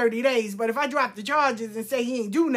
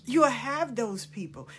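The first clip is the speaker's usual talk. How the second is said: usual speaking voice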